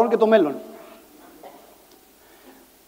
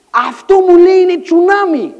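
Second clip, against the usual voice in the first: high, loud dramatic reveal; alarmed announcement; 6 semitones higher, 7 dB louder than usual. Retold or imitated. Friend announcing a tsunami, alarmed. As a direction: sound the alarm, pitched up and loud.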